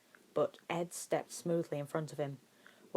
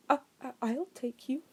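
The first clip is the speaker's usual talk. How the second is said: higher, stammering and nervous; trembling voice; 9 semitones higher than usual. A narrator's reading. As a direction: stumble over the start like a frightened man. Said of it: "a trembling voice said".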